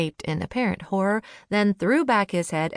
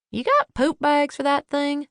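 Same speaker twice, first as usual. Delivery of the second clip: John voice, higher; 6 semitones higher than usual. A narrator's reading. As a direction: ask it with a wry grin. John's jab.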